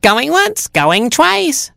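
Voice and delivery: very high screech; auctioneer's shriek as a fox. Acted. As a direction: mockingbird screeching to the hounds. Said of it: "screeched the mockingbird".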